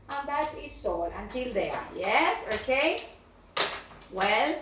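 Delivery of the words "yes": usual speaking voice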